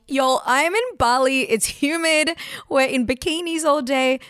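exclaimed protest. Retold or imitated. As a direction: protest loudly to the team, stretching the opening. Retells protesting the tight deadline.

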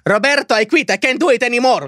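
exasperated resignation. Acted. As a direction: give up at the end of your rope. Receptionist gives up on guest.

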